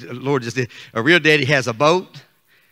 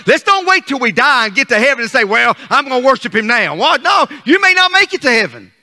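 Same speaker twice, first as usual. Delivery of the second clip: high, loud Southern pulpit delivery; energetic sermon, punching key words; fired-up preaching; 7 semitones higher, 4 dB louder than usual. Real, not acted. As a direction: preach it hard to the congregation. Preaches with rising force.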